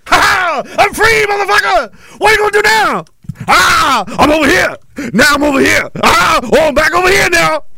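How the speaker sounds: dart about jeering like a kid playing tag; mock gloating taunt. Ranter jeering at viewers he escaped, high mock yells.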